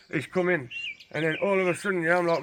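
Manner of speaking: ordinary delivery, nothing marked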